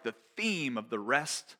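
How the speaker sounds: ordinary talk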